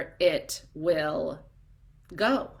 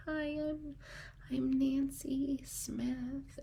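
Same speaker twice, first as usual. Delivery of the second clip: much softer and higher, slow and hesitant; timid introduction; 6 semitones higher, 12 dB quieter, slower than usual. Retold or imitated. Imitating a shy woman at a seminar, meekly. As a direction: shrink back as you say your name. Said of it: "these women stand up and they're like".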